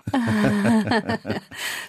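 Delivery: high-pitched burst of laughter; real laugh. Real, not acted. Actor telling a Berlin pub anecdote, laughing high.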